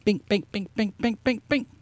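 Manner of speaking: light mouth-made bink chimes; imitated chime sounds. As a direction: tap out each bink like a ding. Mimicking nominee reveal chimes.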